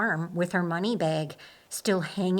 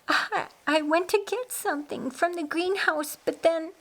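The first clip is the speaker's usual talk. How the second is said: shaken; 9 semitones higher than usual. A narrator's reading. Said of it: "sobbing into his shoulder".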